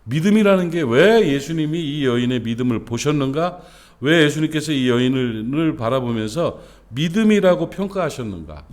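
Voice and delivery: ordinary talk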